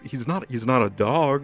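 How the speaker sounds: ordinary talk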